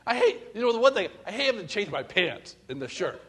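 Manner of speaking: usual speaking voice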